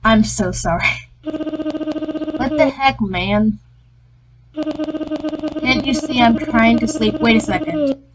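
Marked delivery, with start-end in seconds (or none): 1.2-7.2 slow, higher, drowsy complaint; groggy woken woman. Acted. Woken sleeper grumbles at the player, slow and drowsy. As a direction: stretch it out like someone just woken up.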